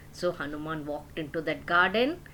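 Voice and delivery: usual speaking voice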